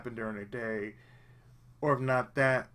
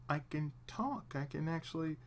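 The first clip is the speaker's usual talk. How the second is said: ordinary talk, nothing marked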